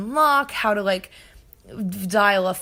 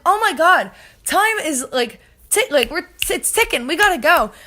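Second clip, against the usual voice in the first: high, loud, urgent pleading; mock-frantic, pitch jumping up; acted-out panic; 7 semitones higher, 6 dB louder than usual. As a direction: replay the panic of being put on hold. Acts out panic while on hold.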